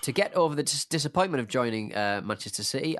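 ordinary talk, nothing marked

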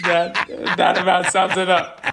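loud, high delight after a punchline; laughing. Real, not acted. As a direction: call it out while cracking up. Cracking up at the joke's punchline.

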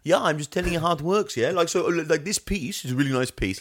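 high, breezy Alsace French accent, pretending to know fashion; a French driver at Fashion Week in a put-on Alsace accent. Esteban faking expertise at Fashion Week, Alsace French accent. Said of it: "given him an Alsace French accent".

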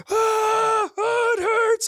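very high mock wail; child wailing in pain. Dad imitating his daughter during hair washing, high pained wail. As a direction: cry out like an overdramatic kid. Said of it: "if I'm washing her hair in the bath, she'll be like".

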